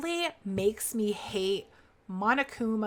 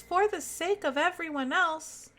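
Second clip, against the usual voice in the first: higher, gentle game character voice; lightly voiced shy character; 7 semitones higher than usual. Streamer reading the shy AI's brave lines, higher voice. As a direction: read the timid AI's lines a little higher.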